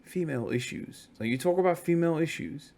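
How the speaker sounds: softly spoken loaded question; hushed, discreet mimicry; imitated quiet asking. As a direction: ask it a little quietly, as if confiding. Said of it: "a little bit quietly".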